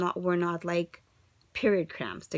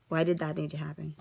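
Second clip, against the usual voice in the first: usual speaking voice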